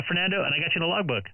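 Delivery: usual speaking voice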